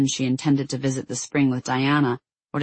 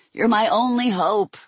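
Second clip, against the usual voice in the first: higher, hammed-up dying rasp; 8 semitones higher, 3 dB louder than usual. A narrator's reading. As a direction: plead like a mock-wounded hero. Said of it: "he rasped".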